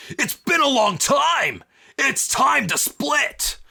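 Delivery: high, loud, slow villain shout; gleeful villain. Acted. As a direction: boom it out grandly before splitting. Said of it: "smiles at Vic and says".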